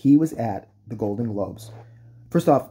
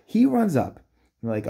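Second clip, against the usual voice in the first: normal talking voice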